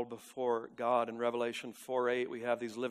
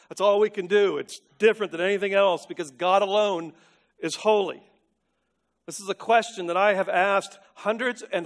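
raised, loud sermon delivery; forceful preaching; 5 semitones higher, 8 dB louder than usual. Real, not acted. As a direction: preach it out with high, driving emphasis.

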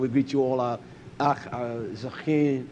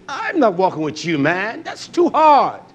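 rising hammed-up shout, much louder at the end; preacher mocking a crowd that refuses, loud; 7 semitones higher, 6 dB louder than usual. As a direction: throw up your hands and yell the refusal. Quoting people refusing.

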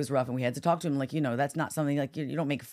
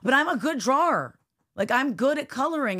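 lightly mimicked kid making an excuse; higher, louder little-boy protest; a hint of a child defending himself; child's protest; 8 semitones higher, 4 dB louder than usual.

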